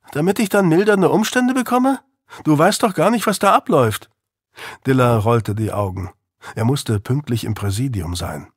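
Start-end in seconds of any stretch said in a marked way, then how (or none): 0.1-4.1 higher, youthful defiant voice; defiant teenage boy. Acted. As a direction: push back like a sulky teen. Narrator voices teenage Louis.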